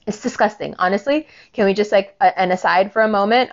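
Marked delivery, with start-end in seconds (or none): none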